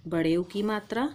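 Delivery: ordinary delivery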